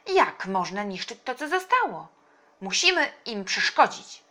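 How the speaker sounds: rally them loudly to act; determined call. A narrator's reading. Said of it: "zawołał wuj i dodał gazu". (głośny, stanowczy okrzyk)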